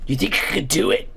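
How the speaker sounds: ordinary delivery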